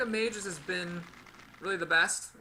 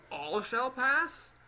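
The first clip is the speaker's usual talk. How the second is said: slow, drawn-out with a rising finish; playful parody of the guardian; mock knight line; 7 semitones higher, 3 dB louder, much slower than usual. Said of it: "Shouldn't he say".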